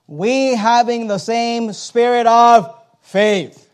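"spirit of faith" drawn out with loud emphasis; emphatic scripture reading. Acted. Preacher showing where scripture puts emphasis, heavy stress on "spirit of faith". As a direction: read slowly and loudly, leaning into "spirit of faith". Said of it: "Notice the emphasis".

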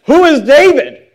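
sneering rich man brushing off David; scornful Nabal. Retold or imitated. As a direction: scoff as if he's nobody. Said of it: "notice how Nabal answers".

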